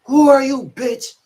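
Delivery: high, loud imitation of a yelling officer; fake yell. Disrespectful officer to inmate, mock yelling. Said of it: "He trying to fake yell at me and shit".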